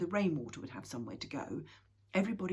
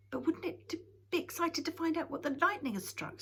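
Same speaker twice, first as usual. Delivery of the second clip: eager; 12 semitones higher, 5 dB louder than usual. Acted. Eager young Moomin Troll.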